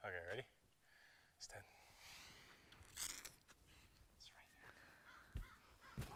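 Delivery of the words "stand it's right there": whispering. Real, not acted. Whispered setup before filming.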